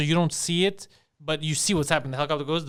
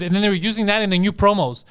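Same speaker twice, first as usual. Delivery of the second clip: normal talking voice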